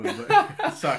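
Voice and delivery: high laugh through sorry; laughing apology. Real, not acted. Laughs at own handshake slip.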